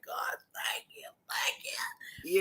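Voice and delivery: breathless whispered gratitude; whispered thanks. Retold or imitated. Woman recalling her thanks to God, whispered. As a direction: breathe the thanks almost silently.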